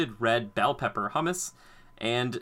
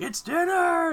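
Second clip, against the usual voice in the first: put-on excited baby giraffe; 5 dB louder, slower than usual. Young giraffe voiced in a story.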